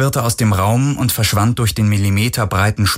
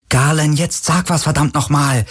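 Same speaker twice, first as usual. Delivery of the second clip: higher-pitched exasperated demand; impatient demand; 8 semitones higher than usual. A narrator's reading. Kirai, through the drone, demands answers from Galen, impatient. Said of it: "forderte die Drohne".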